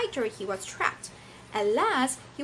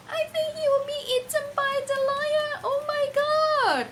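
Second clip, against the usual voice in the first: put-on much higher, excited kid voice; pretend child; 5 dB louder than usual. Teacher voicing a child who fears the mouse gets eaten, high gasp. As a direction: gasp it out like a shocked little pupil.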